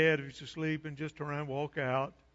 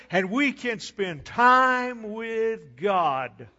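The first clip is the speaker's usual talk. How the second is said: higher-pitched, emphatic pulpit delivery building in force; fervent preaching; 6 semitones higher, 5 dB louder, slower than usual. Real, not acted. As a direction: proclaim it to the congregation with growing conviction. Preaches with rising fervor.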